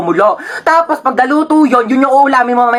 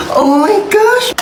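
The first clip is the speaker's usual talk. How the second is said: high, slow drawn-out delight; excited gasp; 9 semitones higher, much slower than usual. Acted. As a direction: stretch it out in awe. Child sees the cheesecake.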